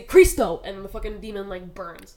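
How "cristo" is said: loud, falling "Cristo" with the voice raised; hammed-up TV exorcist "Cristo"; loud exorcism command. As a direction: call out "Cristo" like driving off a demon. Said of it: "like, when they say".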